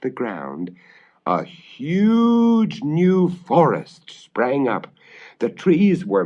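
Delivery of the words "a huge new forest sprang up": high, slow and wondrous; awestruck. A narrator's reading. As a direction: unveil the magic with wonder. Magic forest appears.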